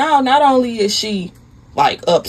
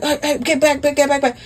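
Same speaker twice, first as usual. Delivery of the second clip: mock panic; 5 semitones higher, faster than usual. Husband shoving lover away.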